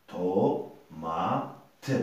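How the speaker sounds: "tomate" drawn out as to... ma... te; slow, spaced-out repeat of "tomate"; syllable by syllable. Shown as a demonstration. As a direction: separate the syllables of "tomate", ending on a light "te". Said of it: "nous avons trois syllabes".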